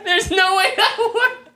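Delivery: high, slow words through laughter; giggling while talking; laughing. Real, not acted. Breaks up during laughing game.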